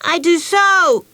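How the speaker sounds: put-on high, slow stubborn child; stubborn insistence. Betsy insists to Meg she goes to school, stubborn. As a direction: dig in your heels on every word. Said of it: "Betsy insisted stubbornly".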